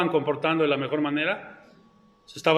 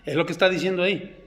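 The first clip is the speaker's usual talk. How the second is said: normal talking voice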